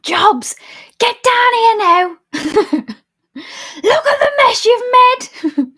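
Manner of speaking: loud, high-pitched mum yelling a telling-off; drawn-out high shout, scolding a child; mom impression. Streamer mimicking mom on request, loud high scolding. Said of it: "Do an impression of your mom".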